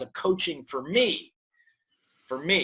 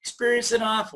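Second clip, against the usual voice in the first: raised voice of self-doubt; nagging inner voice; 6 semitones higher than usual. Acted. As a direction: list failings like a discouraging mother, pitched up. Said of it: "this little voice that goes".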